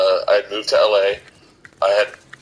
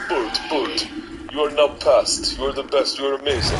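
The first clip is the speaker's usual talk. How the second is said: a light put-on accent for Manny, with a raised pitch; Manny's accent; 4 semitones higher than usual. Club owner Manny praising the comic after his set, lightly accented. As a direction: mimic Manny's accent loosely while praising. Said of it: "but Manny doesn't have an accent".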